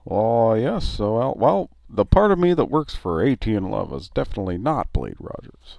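lightly voiced other guy. Voicing Dan answering.

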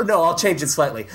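ordinary delivery

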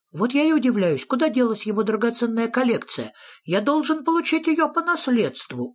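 high-pitched little creature; high character voice, querulous; wondering Sniff. A narrator's reading. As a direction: wonder aloud in a high, insistent voice. Sniff voiced, claiming inheritance.

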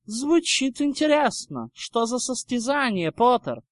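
high-pitched, mocking drawl. Draco asking Potter what contest, drawling. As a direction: needle him with feigned interest.

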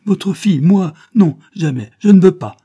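loud, high-pitched outraged protest; vehement refusal. A narrator's reading. Young woman rejecting the count as her father, vehement refusal. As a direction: cry out in flat-out refusal.

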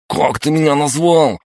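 high outraged yell. A narrator's reading. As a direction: scream the question. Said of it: "завопил тот".

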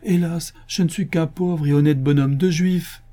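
high, woeful questions and lament; plaintive pleading. Acted. Poor honest man lamenting to the commissioner, plaintive pleading. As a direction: bemoan your helplessness.

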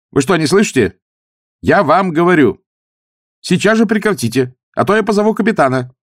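loud, high demand through a door. A narrator's reading. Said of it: "донеслось снова из-за двери".

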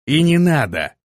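devil-may-care answer; reckless bravado. A narrator's reading. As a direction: toss it off recklessly. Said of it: "с каким-то накрывшим его чувством бесшабашности ответил".

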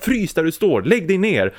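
high-pitched mock shout of an order; shouting guard. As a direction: call out orders like a guard, without full volume. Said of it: "så ropar säkerhetsvakten".

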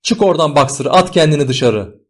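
high, loud pleading command; urgent shout. A narrator's reading. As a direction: yell for someone to jump out. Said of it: "diye bağırıyorlardı".

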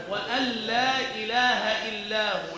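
slow recited وأن لا with the nun merged into lam; Quranic recitation. Shown as a demonstration. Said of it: "في سورة هود مثلًا".

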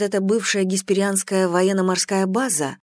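plain reading voice

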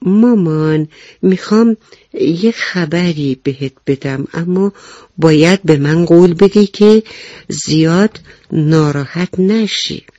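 ordinary delivery, nothing marked

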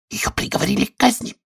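soft, high, whispery confidence; whisper in ear. A narrator's reading. Rabbit whispers a secret into Alice's ear. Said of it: "шепнул ей прямо в ухо".

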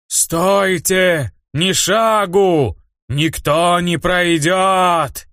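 slow wailing ghost howl; sobbing ghost voice. Acted. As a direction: stretch every word into a moaning wail. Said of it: "Завыли и застонали призраки".

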